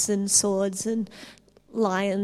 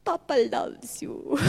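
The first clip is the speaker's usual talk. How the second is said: tender; 3 semitones higher, slower than usual. Retold or imitated. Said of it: "quoting the father's love".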